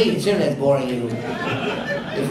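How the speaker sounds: teasing through laughter; laughing. Real, not acted. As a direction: chuckle while ribbing him. Teasing yawning audience member.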